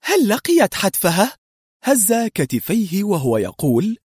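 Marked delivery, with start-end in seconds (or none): 0.0-1.4 higher-pitched surprised asking; astonished question. A narrator's reading. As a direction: ask in amazement, pitch lifted. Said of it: "سألته في دهشة". (سؤال مندهش بنبرة مرتفعة)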